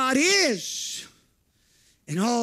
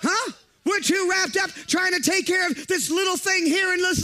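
fiery preaching; 6 semitones higher than usual. Acted. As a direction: preach it hard to the congregation. Preaching at full force.

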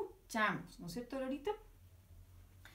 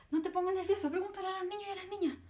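excited urging; 8 semitones higher, much faster than usual. Acted.

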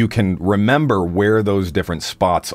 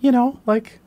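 talking like this. Shown as a demonstration. Demo of a high voice for the teacher's analogy. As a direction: float the voice way up. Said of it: "just talk like this".

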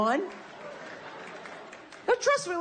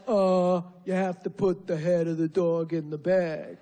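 mocking stewardess voice; 4 semitones lower than usual. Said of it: "she's like".

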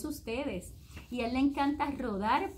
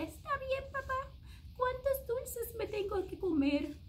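put-on child's reply; 12 semitones higher than usual. A narrator's reading. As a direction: answer meekly like a small kid. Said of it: "el niño le dice".

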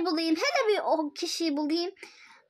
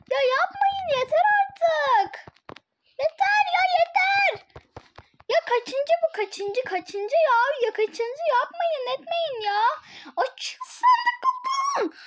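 high, desperate tearful wail; real crying; 7 semitones higher, slower than usual. Real, not acted. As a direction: plead shrilly that it's enough, in tears. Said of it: "Niye ağlıyorsun?". (ağlayarak, tiz sesle isyan)

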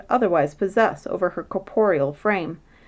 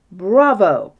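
louder sudden cheer of approval; cried out; 8 dB louder than usual. Acted. King approving the queen's plan, a loud cheer. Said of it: "cried the king".

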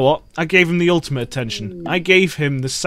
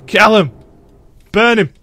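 loud furious orders at the screen; real frustrated shouting; angry yelling; 5 semitones higher, 8 dB louder than usual. Real, not acted. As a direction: bark it out, fed up with them. Yelling at colonists in game.

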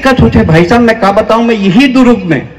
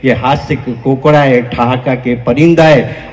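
ordinary talk, nothing marked

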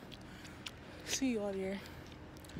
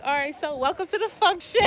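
excited intro; 5 semitones higher, 9 dB louder than usual. Retold or imitated. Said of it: "I'm be like".

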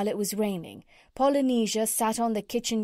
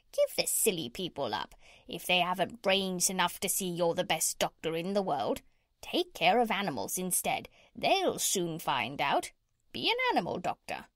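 squawky parrot voice with swooping pitch. Acted. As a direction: play a bossy parrot giving advice.

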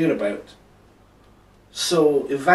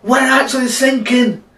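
very high, loud alarm; panicked cry; 11 semitones higher, 9 dB louder than usual. Acted. Sailor warns that the boat is sinking, panicked cry. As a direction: shout it out in acted panic. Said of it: "cried a sailor".

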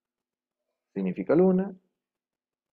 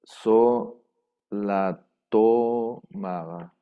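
"so la tomaba" stretched slowly, each syllable held; slow drawn-out demo; much slower than usual. Shown as a demonstration. Teacher to class, drawn-out pronunciation demo.

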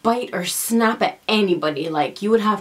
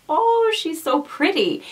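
gushing visitor; 9 semitones higher, slower than usual. Retold or imitated. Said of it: "they're like".

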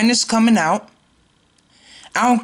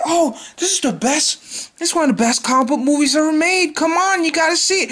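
laughing, higher mock-fan voice; gushing fan; 9 semitones higher than usual. Vlogger mocking fans who push the reboot, higher and laughing. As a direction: parody a fan hyping the movie. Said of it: "It's like".

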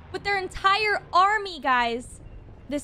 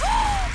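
high startled gasp; 11 semitones higher than usual. Real, not acted. Remembers the drill men's fate.

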